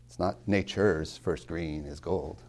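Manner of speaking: nature's slow, first syllable weighted; stress on nature's. Shown as a demonstration. Professor shows the class the trochee, slow stress. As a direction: lean on NAture's. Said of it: "that's a trochee".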